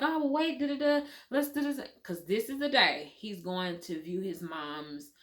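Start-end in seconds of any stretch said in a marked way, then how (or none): 0.0-1.8 higher-pitched imitation of a husband; husband's voice. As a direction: mimic a husband stalling, pitched up. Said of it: "He was like".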